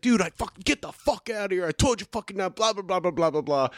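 high-pitched imitation of an upset bouncer; retold tirade, high and rushed-off; mock angry rant. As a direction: rant furiously, dissolving into blah blah. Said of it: "he gets pissed off. He's fucking upset".

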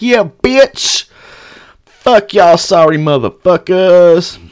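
high, drawn-out jeer; taunting yell. Retold or imitated. Escorted-out fan to staring Kings fans, taunting holler. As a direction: crow it at the onlookers.

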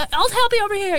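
high-pitched call to a customer. Retold or imitated. Calls a customer over.

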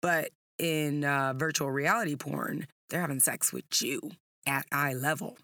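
usual speaking voice

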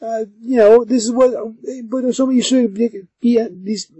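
high, fumbling nervous voice; flustered Basil. Retold or imitated. Basil fumbling for an answer to Kate, high and flustered. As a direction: stammer it out high, caught off guard. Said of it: "he's like".

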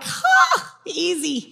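mock surprise. To boyfriend moving in, a high surprised yelp then a cool shrug. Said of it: "And I'm like".

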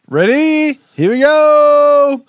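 high, loud, drawn-out countdown; excited. Real, not acted. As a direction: hype it up before the bite. About to eat sour candy.

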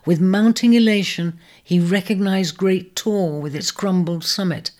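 usual speaking voice

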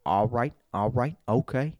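lightly voiced movie-star impression, a touch low; Denzel Washington. Comic making a point about impressions, lightly impersonating an actor. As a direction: do a hint of the actor's voice. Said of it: "doing a Denzel Washington impression".

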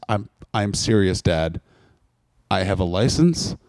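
low, deadly serious threat; low stern son voice. Retold or imitated. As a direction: warn him in a low, grave voice. Son threatening dad in story.

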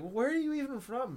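high, loud incredulous question; imitating a bewildered gamer; confused. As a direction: demand it in exasperated bafflement. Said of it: "he got so confused".

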